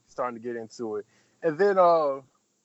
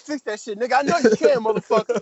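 loud mock yelling, pitched way up; retold yell; 7 semitones higher, 7 dB louder than usual. Retelling how he yelled at the studio engineer, loud mock yell. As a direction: bark it at the sound guy like you're fed up.

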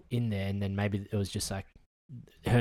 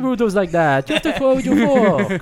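laughing; 13 semitones higher, 10 dB louder than usual. Real, not acted. Laughing at a TikTok.

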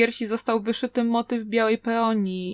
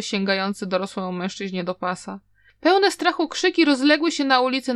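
plain reading voice

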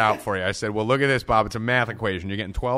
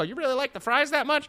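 much higher, playful imitation of the girl; a light put-on girl voice; mock girl voice; 14 semitones higher than usual. Friend voicing the Chick-fil-A girl, light high put-on voice. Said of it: "She'll go".

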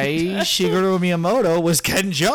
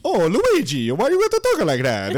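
raised, bouncy cartoon Italian; mock Italian game character; 3 semitones higher than usual. One game brother questioning Luigi, singsong mock Italian. Acting Mario replying to Luigi.

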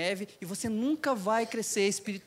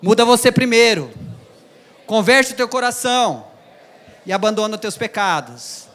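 much louder exhortation; repeat to your neighbor; 8 dB louder than usual. Shown as a demonstration. Preacher leading the church to tell their neighbor, loud call. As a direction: proclaim it to the person beside you. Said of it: "Diz o seu irmão que tá do lado".

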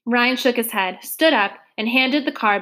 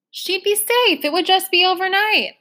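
much higher, soothing policewoman tone; lightly voiced officer; 9 semitones higher than usual. Policewoman calming Trotter, higher voiced and reassuring. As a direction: reassure her it's only one night.

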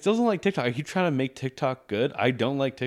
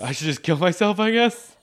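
rising higher and louder into a whine; hammed-up nervous panic; mock despair; 7 semitones higher, 6 dB louder than usual. At the border, mock panicked whine rising high. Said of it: "and I'm just like".

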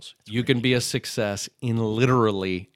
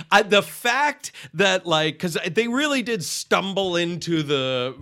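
ordinary delivery

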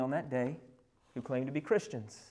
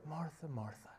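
tender call; 12 dB quieter than usual. Acted. Jesus gently addressing Martha.

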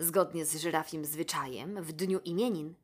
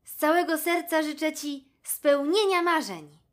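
much higher, louder jackal voice; jackal's heartfelt wish; 9 semitones higher, 8 dB louder than usual. A narrator's reading. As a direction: wish her dreams come true as the jackal. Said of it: "dodał". (szakal serdecznie życzy, wysokim, głośniejszym głosem)

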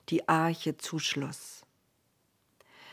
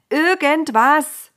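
insistent repeat; 8 semitones higher, 8 dB louder, slower than usual. Acted. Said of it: "wiederholte Frau Noah".

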